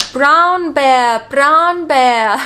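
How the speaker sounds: imitating child's Italian-accented English, slow and loud; drawn-out Italian-accented mimicry. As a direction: mimic an Italian learner's English, vowels stretched. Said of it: "with an Italian accent".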